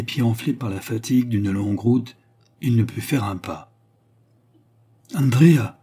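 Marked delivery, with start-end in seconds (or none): 5.1-5.8 appel fort et aigu, plein de compassion; cri. A narrator's reading. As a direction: crier son nom, bouleversé. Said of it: "s'écria Armand". (s'écrie Andrea, plus haut et plus fort)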